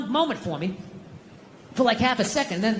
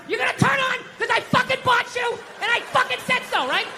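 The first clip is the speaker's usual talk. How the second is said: high mock yell at the TV; 14 semitones higher than usual. Dad yelling at the TV in mock fury.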